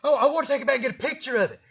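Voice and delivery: high, quick eager excuse. Retold or imitated. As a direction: rattle off the plan like a kid. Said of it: "And I'm like".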